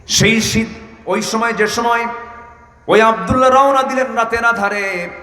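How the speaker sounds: high, sustained preacher's chant; full-force preaching. Real, not acted. Preacher telling of Abdullah's night departure, impassioned preaching. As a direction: lift the story into a high sermon call.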